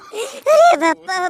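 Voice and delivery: slow stretched-out mock wail. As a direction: drag the cry out like a hammed-up mourner. Comic wailing lament. (नाटकीय रोने वाली पुकार)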